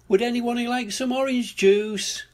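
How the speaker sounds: high singsong call from the house. A narrator's reading. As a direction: call the children in, pitched high. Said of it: "called Mummy Pig from the house".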